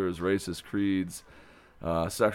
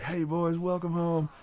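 cheerful greeting; 9 semitones higher than usual. Retold or imitated. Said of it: "And I'm like".

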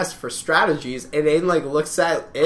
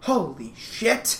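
slow, softer, awed "Holy shit"; understated amazement; 3 semitones higher, 6 dB quieter, much slower than usual. Retold or imitated. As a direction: let "Holy shit" out slowly, without shouting. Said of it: "it was more just like a".

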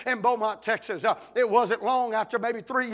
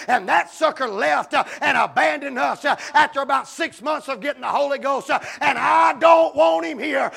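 louder and louder, ending in a shout; angry rant; 6 dB louder than usual. Retold or imitated. Board member ranting to the pastor, building to a shout. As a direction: build the grievance to a furious yell.

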